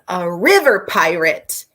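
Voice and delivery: loud, punched-out announcement of a pirate; playful yell. Real, not acted. Vlogger introducing the book's river pirate, playful yell. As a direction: yell it with storybook relish. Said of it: "I just choked myself up yelling that".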